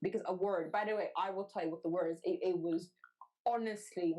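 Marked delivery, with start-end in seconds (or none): none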